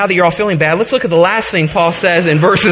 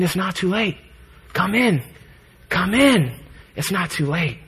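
much softer, gentle pleading call; tender invitation; 6 dB quieter than usual. Pretend. God to Israel holding out his arms, soft tender plea. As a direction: softly coax them back home.

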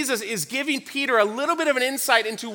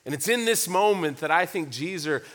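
ordinary delivery

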